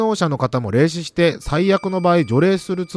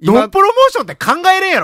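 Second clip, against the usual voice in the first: excited; 5 dB louder, faster than usual. Real, not acted. Excited exclaim about promotion.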